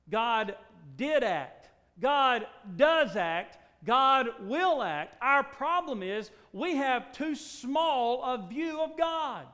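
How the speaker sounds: loud, high, slow proclamation; slow thundering preaching. Acted. Preacher proclaiming God acts, slow and loud. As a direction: pound out each word slowly.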